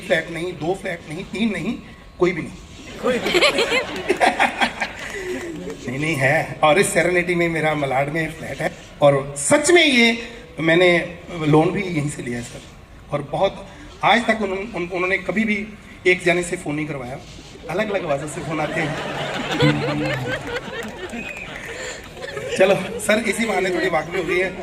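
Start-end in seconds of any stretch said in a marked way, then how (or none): 2.9-5.8 long laugh. Real, not acted. Laugh at own joke. 19.0-22.5 long laugh. Real, not acted. Laugh at own joke.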